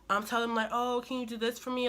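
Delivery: ordinary delivery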